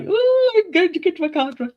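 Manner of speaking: put-on high, excited little-kid squeal; squeaky mock giddy kid. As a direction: imitate an over-excited child's energy. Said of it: "like a little giddy kid".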